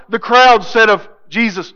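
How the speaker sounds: ordinary delivery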